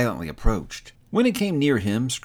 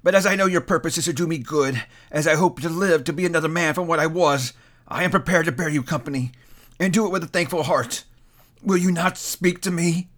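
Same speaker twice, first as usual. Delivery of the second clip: higher, trembling entreaty; fearful plea; 6 semitones higher than usual. A narrator's reading. Scrooge pleading fearfully with the silent ghost. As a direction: beg the silent ghost to answer.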